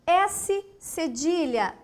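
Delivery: ordinary talk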